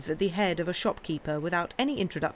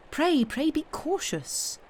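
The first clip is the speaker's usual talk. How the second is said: higher, slow anxious pleading; begging her gently to be careful; anxious plea; 10 semitones higher, slower than usual. Acted. Lucy urging Miss Pross to caution, slow anxious plea. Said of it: "cried Lucy".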